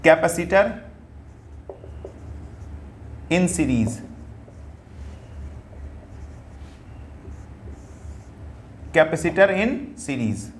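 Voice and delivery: broken into pieces with long pauses; teacher dictating a heading, slowly; slow dictation. Shown as a demonstration. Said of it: "discuss करते हैं in series".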